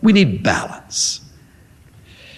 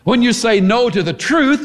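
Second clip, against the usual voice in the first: ordinary talk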